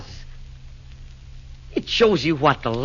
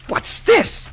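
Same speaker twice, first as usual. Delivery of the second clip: surprised squeal; 4 dB louder than usual. Acted. Nero discovers the present.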